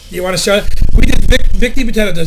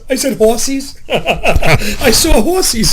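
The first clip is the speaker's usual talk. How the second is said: laughing; 3 semitones higher, much slower than usual. Retold or imitated. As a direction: imitate a wife giggling at herself. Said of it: "she starts laughing".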